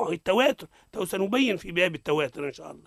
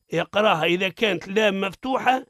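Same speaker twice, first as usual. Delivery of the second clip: ordinary talk, nothing marked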